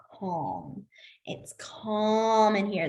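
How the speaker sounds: slow whispered calm. Shown as a demonstration.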